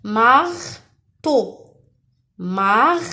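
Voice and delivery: "Marteau" as mar, pause, teau; syllable by syllable. Shown as a demonstration. Teacher asking first or second syllable, "Marteau" split in two. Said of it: "À la première ou la deuxième?".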